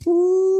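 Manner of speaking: high, drawn-out "ooh"; long high keening moan; imitated crying wail. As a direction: mimic an old man breaking into sobs. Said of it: "fell back on his bed crying".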